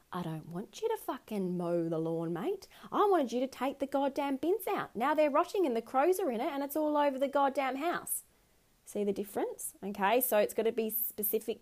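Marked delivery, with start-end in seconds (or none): 0.1-8.2 angry partner rant. Acted. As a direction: tell off the partner who got it wrong. Partner scolding about the bins.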